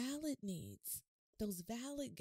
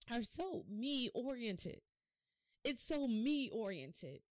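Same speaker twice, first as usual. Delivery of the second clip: slow and louder, stretching so me-oriented; slow emphasis; 2 semitones higher, 4 dB louder, much slower than usual. Real, not acted. Preacher stresses to church that lives are self-centred, slowly. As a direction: drag out the point.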